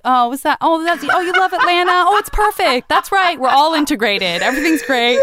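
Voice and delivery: high, loud, sarcastic delight; mock gushing agreement. Makeup artist ribbing her about loving Atlanta, mock gushing. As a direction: imitate someone humoring a naive friend.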